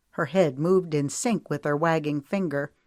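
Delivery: usual speaking voice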